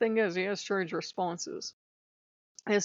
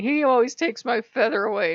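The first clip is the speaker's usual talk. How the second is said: loud whiny complaint, higher; whining; 4 semitones higher, 7 dB louder than usual.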